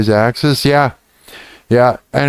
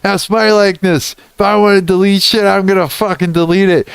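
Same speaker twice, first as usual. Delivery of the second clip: higher, laughing mock rage at the app; hammed-up threat, pitched up; mock furious rant; 8 semitones higher than usual. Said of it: "was like".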